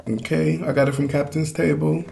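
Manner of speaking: ordinary delivery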